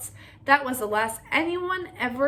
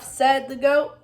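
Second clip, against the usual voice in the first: usual speaking voice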